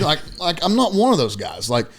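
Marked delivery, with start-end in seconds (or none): none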